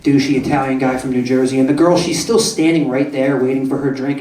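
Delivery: usual speaking voice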